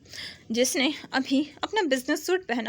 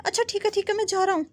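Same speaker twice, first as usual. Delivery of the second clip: hurried; 7 semitones higher, faster than usual. Acted. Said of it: "जल्दी से कहते हैं".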